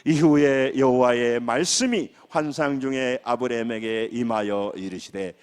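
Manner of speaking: slow, measured congregational reading; loud scripture reading. Real, not acted. Pastor leading congregation in reading a verse aloud, loud scripture reading. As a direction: read the verse out slowly and firmly. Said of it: "큰 소리 읽으면 시작".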